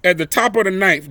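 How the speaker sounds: usual speaking voice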